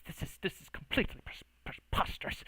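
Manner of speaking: whispered, stammering disbelief; flustered sputter. Acted. Mage objecting to Artorias, in a sputtering whisper. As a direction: sputter it under your breath. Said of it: "Uwe Boll kind of goes".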